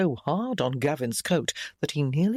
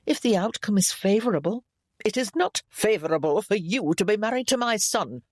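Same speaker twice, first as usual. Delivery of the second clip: lightly voiced, imperious Lady Keithley, higher pitched; a hint of a disdainful mother-in-law, pitched up; haughty disapproving mother; 5 semitones higher than usual. A narrator's reading. Disapproving mother to her son's new wife, lightly voiced haughty.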